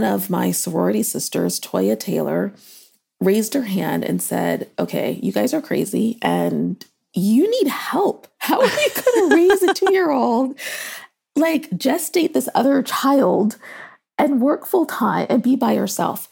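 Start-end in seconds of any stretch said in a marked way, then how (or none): 4.8-16.3 concerned friend. Retold or imitated. Friend's blunt intervention retold.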